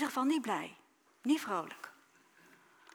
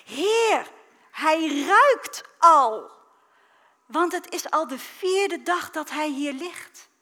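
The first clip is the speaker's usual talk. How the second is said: higher, louder, drawn out on ruikt; loud protest; 5 semitones higher, 10 dB louder, slower than usual. Acted. Martha warns Jesus at the tomb, loud and alarmed. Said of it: "En Martha ... zei".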